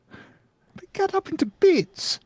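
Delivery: rising panic. Acted. Repeated, higher and rising panic.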